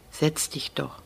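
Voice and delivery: soft, deep and calm; low and calm. A narrator's reading. Kapo gently invites the crying boy to sit, calm and low. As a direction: settle him with a quiet low voice. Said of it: "Die Stimme des Kapos klingt tief und ruhig".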